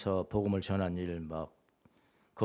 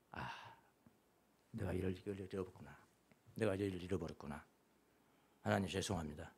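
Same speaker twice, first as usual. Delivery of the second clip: repentant prayer; 4 semitones lower, 12 dB quieter than usual. Retold or imitated. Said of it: "이렇게 물었더니".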